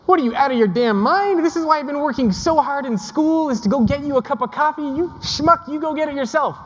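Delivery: imagined rant at the boss, hammed-up; mock inner rant. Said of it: "is what I said on the inside".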